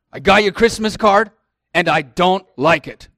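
lightly voiced grumpy dad; imitating his dad. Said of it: "That's how he sounds".

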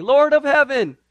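high, loud pleading prayer; praying believers pleading loudly for Peter; fervent praying church. Acted. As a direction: beg heaven urgently, slowing on please. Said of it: "they were praying".

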